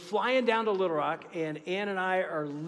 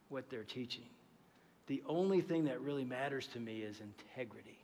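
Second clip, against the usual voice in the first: lower, softer, grave and deliberate; earnest mentor; 5 semitones lower, 5 dB quieter than usual. Retold or imitated. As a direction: pass on the one thing that matters, quietly. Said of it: "and he goes".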